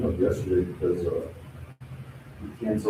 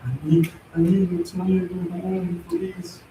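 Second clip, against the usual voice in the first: imitating a tearful beg, pitched higher; mock crying plea; 8 semitones higher, slower than usual. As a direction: whine it like a crackhead pleading for cash, half-hearted. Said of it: "And he starts crying".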